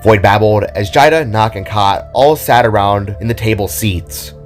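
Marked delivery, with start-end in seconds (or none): none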